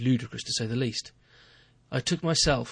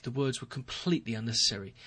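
plain reading voice

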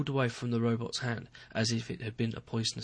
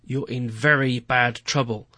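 slow, louder and heavy with doom; gloomy; 8 dB louder, slower than usual. Acted. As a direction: lay the bad news on slowly. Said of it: "in as gloomy a voice as I could muster".

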